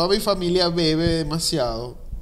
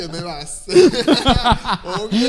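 stifled giggles; 5 semitones higher, 5 dB louder, much slower than usual. Real, not acted. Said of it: "yo no puedo aguantar la risa".

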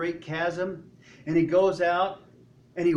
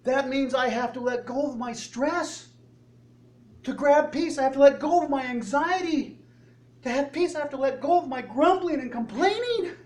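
high, loud, mounting objection; incredulous protest; 7 semitones higher, 5 dB louder than usual. Acted. Believer balking at letting go of stress, indignant protest. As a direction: balk at each thing to give up. Acts crowd balking at the cost.

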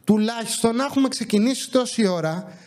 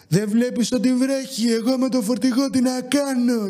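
mocking the driver; 5 semitones higher than usual.

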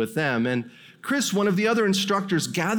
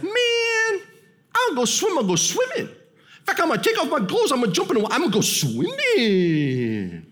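Dan's voice; 9 semitones higher than usual. Retold or imitated. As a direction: play a cocky meathead bragging he'll swim anyway. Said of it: "And that's how he talked".

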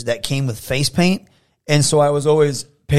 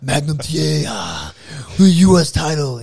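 put-on shouted, heavy Russian accent; Russian wrestler accent; 5 dB louder than usual. Fan impressing wrestlers with a shouted Russian accent. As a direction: bark it at Magnum like Ivan Koloff. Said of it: "best impression I've heard yet".

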